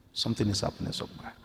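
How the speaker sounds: fast hushed whisper; whispered aside. Real, not acted. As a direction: breathe it quickly under your breath.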